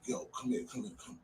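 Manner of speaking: voiceless whisper, beckoning him closer; urgent whisper. Retold or imitated. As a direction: whisper it so nobody outside hears. Friend whispering him into bathroom.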